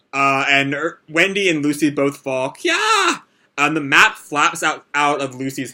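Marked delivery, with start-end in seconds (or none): 2.5-3.2 very high short squeal; girlish shriek. Acted. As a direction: squeal as you tumble. Girls fall and shriek.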